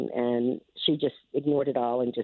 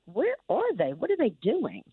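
higher, swooping questions; retold impatient fretting in a higher voice; exasperated wondering; 8 semitones higher than usual. Grandmother recalls waiting with her husband for latecomers, exasperated. Acting out couple's anxious wondering.

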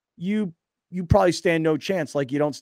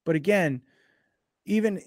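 usual speaking voice

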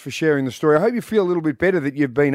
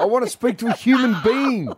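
higher, exasperated plea for a human; frustrated caller; 10 semitones higher than usual. Pretend. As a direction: beg the machine in despair. Caller stuck in phone menu.

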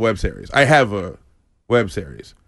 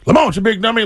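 taunting schoolkids; 10 semitones higher, faster than usual. Retold or imitated. Comedian recalls schoolmates mocking his name, high taunting. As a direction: sing it out like a playground bully. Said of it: "my friends".

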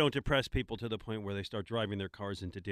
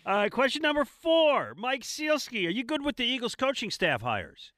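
announcer voice; 9 dB louder than usual. Acted. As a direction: boom out the next question like a host.

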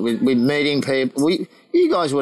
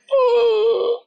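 high drawn-out mock gasp of awe; amazed "ooh"; 5 dB louder than usual. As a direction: let out a high, impressed ooh. Retelling his amazed reaction.

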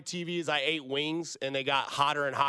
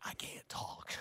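whispered, mouth on fire; barely gets the words out in a whisper; 14 dB quieter, slower than usual. Retold or imitated. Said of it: "And I was like".

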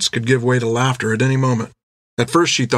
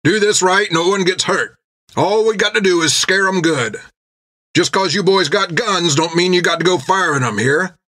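rough sailor voice; 7 semitones higher than usual. Acted. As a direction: bark it like a pirate mate. Jack voiced addressing crew.

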